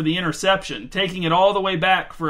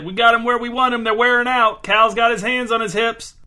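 higher, louder, fast trash talk; gleeful taunt; 5 semitones higher, 4 dB louder than usual. A narrator's reading. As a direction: rib the tired player with glee. Teasing taunt across the field.

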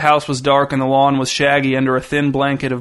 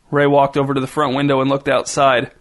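ordinary delivery, nothing marked

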